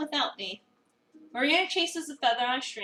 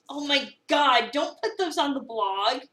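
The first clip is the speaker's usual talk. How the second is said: mortified; 5 dB louder, slower than usual. Acted. Avery mortified by the photos.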